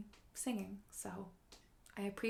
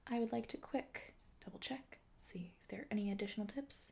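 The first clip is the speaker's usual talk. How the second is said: quick breathy whispered aside; 5 dB quieter than usual. Acted. Streamer whispering while checking for viewer tips. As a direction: rattle it off in a soft whisper.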